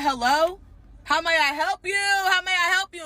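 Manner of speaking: loud, high, sarcastic greeting; fake-sweet but loud phone answer; a bride answering the mistress's call with sarcastic politeness; mock polite.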